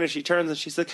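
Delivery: ordinary talk, nothing marked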